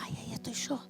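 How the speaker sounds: whispered to herself. Retold or imitated. Watching the hell film, whispering to herself. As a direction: breathe it out in awe. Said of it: "yo miraba la película y decía".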